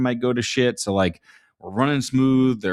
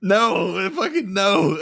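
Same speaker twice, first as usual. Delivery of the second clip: laughing denial; 10 semitones higher, much slower than usual. Real, not acted. Laughing off being called old.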